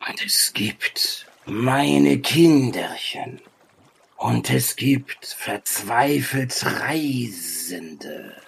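slow, partly whispered oracle; long pauses between stretched, breath-heavy phrases; cryptic ancient sage. Acted. Sage speaks in riddles to the travellers, slow and cryptic. Game master voices the sage.

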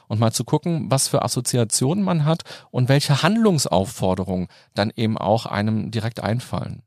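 ordinary talk, nothing marked

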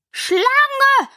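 high-pitched pigeon shriek; screech. A narrator's reading. Pigeon attacks Alice as a snake, screeching. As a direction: screech the accusation far up high. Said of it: "kreischte die Taube".

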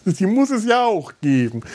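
laughing. Real, not acted. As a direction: chuckle through the line. Joking about a servant's role. (lachend gesprochen)

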